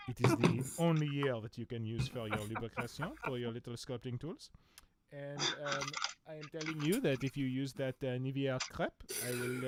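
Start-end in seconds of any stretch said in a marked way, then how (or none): 0.1-7.2 playful fake French accent; hammy French snob voice. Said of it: "my weird French accents".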